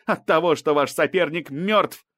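heated, loud attack; almost shouting. A narrator's reading. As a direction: drive the accusation home, nearly yelling. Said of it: "Балбай почти кричала".

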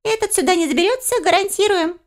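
very high, piping little voice; chuckly creature reassuring. A narrator's reading. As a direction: reassure in a tiny squeaky voice. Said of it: "успокоил смешливый обитатель дупла".

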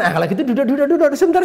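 excitedly retold urging, pitch climbing; urgent invitation. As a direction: urge him to sit down at once. Urging inmate to sit and explain.

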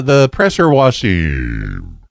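slow, glitchy, robotic phone line; robot voice from a bad connection. Real, not acted. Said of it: "it sounds like I'm talking to a robot".

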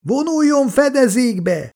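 very high, drawn-out cry; shouted warning. A narrator's reading. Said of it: "kiabálja Jay". (magas hangú, elnyújtott figyelmeztető kiáltás)

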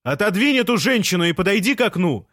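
very high, carrying shout; calling out. A narrator's reading. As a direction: holler up to someone in a window. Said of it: "крикнул Жан".

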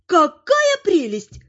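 high, drawn-out gushing delight. A narrator's reading. Grandmother delighted at meeting robbers, gleeful. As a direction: coo it high and slow with glee. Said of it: "обрадовалась я".

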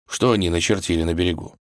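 ordinary delivery

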